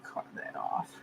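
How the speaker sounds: very soft whisper to yourself; hushed, barely voiced self-talk; absorbed murmur. Real, not acted. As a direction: murmur under your breath while concentrating on carving. Murmuring to self while carving.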